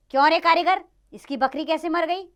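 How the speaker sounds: high, loud royal demand; accusing interrogation. Acted. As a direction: call the accused to account. King interrogates the mason.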